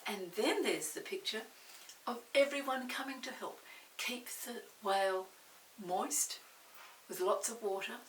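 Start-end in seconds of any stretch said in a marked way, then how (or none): none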